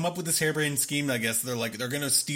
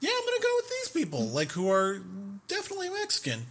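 very high, fast cartoon voice; mocking Jack agreeing to leave in a very high squeaky voice; 5 semitones higher, 3 dB quieter than usual. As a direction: squeak it out like a clueless kid. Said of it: "he's just like".

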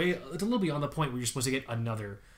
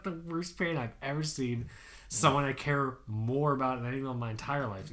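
ordinary talk, nothing marked

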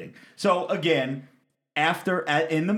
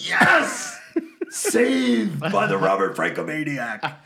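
mock-celebrate a lucky save; big hammed-up triumphant yell; triumphant cheer; 3 semitones higher, 5 dB louder, slower than usual. Retells his triumphant relief.

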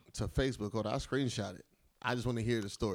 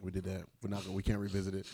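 usual speaking voice

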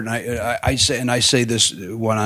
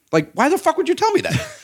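high-pitched comic exasperation; indignant disbelief. Retold or imitated. Comic retorts to whoever warned him before the interview, exasperated disbelief. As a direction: protest in a high, incredulous voice. Indignant at being told she's reluctant.

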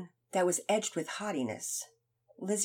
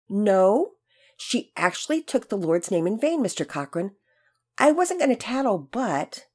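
a hint of an eager boy; 3 semitones higher, 7 dB louder than usual. Finn tattling to teacher.

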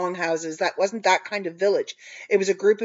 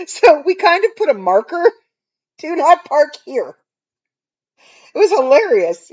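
laughing; 9 semitones higher, 8 dB louder than usual. Real, not acted. Laughing retelling of the car.

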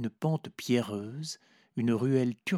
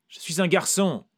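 cried out; 8 semitones higher, 6 dB louder than usual. A narrator's reading. The narrator cries out to Vera, higher and louder. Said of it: "ai-je crié".

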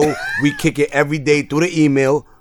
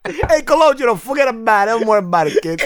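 mock Italian-American; 7 semitones higher than usual. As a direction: play a goomba waving it off, Italian-American style. Mock Italian mobster talk.

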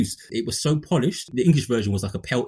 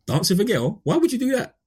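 mock-baffled, as if the idea is absurd; high, incredulous question; incredulous retort; 9 semitones higher than usual. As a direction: pitch jumping high in disbelief. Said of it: "I'm like".

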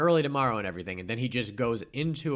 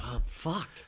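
higher-pitched mock dismay; imitating dismayed realization; 5 semitones higher, 4 dB quieter, slower than usual. Stu spotting the planted gun, imitated dismay. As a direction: act out a sinking realization. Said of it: "he sees the outline of a gun".

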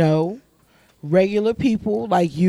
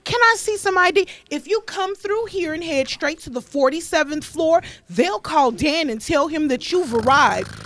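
professional receptionist voice; 7 semitones higher than usual. Retold or imitated. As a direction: greet the caller like a front desk. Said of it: "with the most professional voice".

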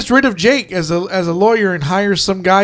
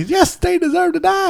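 Sam Jackson; 11 semitones higher than usual. Retold or imitated. Quoting Sam Jackson's movie line, put-on voice. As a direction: deliver the courtroom line like the actor. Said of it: "Sam Jackson".

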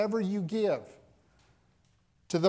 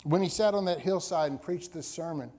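ordinary talk

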